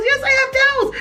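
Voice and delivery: high, laughing imitation of Ryan; mock Ryan's eager answer; quoting Ryan. Ryan answering the woman, high and laughing. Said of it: "Ryan goes".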